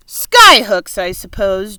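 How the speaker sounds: loud mocking jeer. A narrator's reading. Centipede jeering at James's plan, loud and mocking. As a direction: sneer it at James. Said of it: "jeered the centipede".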